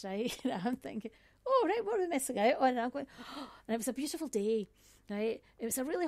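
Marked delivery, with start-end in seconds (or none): 1.5-2.7 eager curiosity. Retold or imitated. Excitedly wondering what's happening.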